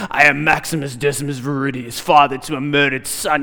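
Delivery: low, grave movie-hero voice; Maximus impression. Preacher imitating Maximus addressing his army, grave and low. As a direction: deliver it like a gladiator before battle. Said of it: "some general to stand in front of his army".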